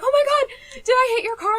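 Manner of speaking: high, loud panicky squeal; imitating her frantic teenage self; panicked. Teen panicking to stranger.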